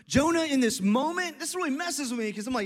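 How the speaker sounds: usual speaking voice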